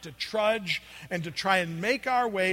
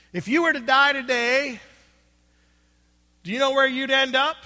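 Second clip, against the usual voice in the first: loud, high, in-your-face street-preacher call; loud, obnoxious; 6 semitones higher, 9 dB louder than usual. Retold or imitated. Street preacher confronting a passing stranger, loud and obnoxious. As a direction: bellow it at a stranger across the store. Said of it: "It's kinda real loud".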